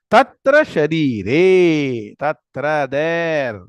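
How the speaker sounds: drawn-out teacherly recitation; slow recitation. Shown as a demonstration. Teacher explaining the verse, reciting slowly word by word. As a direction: recite it slowly so students follow.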